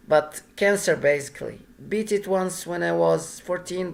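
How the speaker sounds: usual speaking voice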